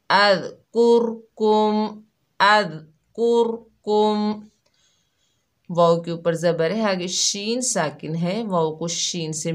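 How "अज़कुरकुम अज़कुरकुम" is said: slow, drawn-out chanted recitation, higher; Quran recitation. Shown as a demonstration. Tajweed teacher shows the word to students, slow chanted recitation. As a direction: recite it slowly like tilawat. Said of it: "हमने जाहिर करके पढ़ा".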